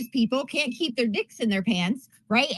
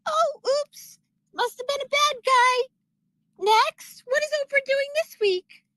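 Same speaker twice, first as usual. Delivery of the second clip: mock airhead fan. A sarcastic host mimicking Oprah viewers in a high mock voice. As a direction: play a ditzy daytime-TV fan shrugging it off. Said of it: "we're all just like".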